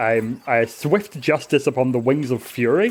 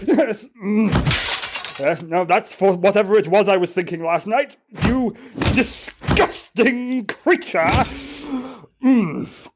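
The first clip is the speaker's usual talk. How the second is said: higher-pitched, self-scolding character voice; self-punishing zealot; 6 semitones higher than usual. Acted. Zealot scolding himself after hammering his own back, self-punishing.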